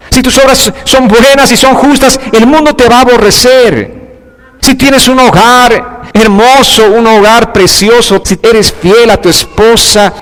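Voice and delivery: fiery pulpit delivery, pressing every line home; full-force preaching. Acted. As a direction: preach it to the whole congregation.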